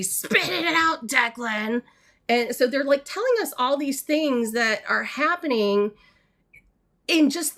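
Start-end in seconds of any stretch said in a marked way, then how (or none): 0.0-1.8 impatient grandparent. Retold or imitated. Grandparents scolding stammering grandson.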